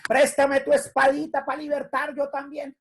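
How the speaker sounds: sarcastic mock supplication; mock pleading to a statue. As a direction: ridicule prayer to a bust. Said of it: "Dígale".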